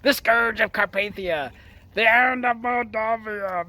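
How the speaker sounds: hammed-up villain proclamation; mock-grand movie villain, lightly voiced; a light nod to the painting villain; imitating Vigo. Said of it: "he's Vigo".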